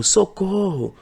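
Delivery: high-pitched mock call of Socorro; cry for help. Shown as a demonstration. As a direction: act out calling Socorro for help. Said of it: "pedido de socorro".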